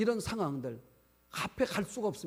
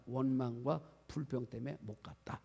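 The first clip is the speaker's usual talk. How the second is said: hushed whisper, lower; 4 semitones lower, 7 dB quieter than usual. Real, not acted. Preacher confides scripture's verdict to congregation, whispering. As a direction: drop to a secret whisper.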